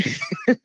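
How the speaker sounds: high, soft burst of laughter; can't help laughing at your own joke; real laugh. Real, not acted. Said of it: "I have to laugh".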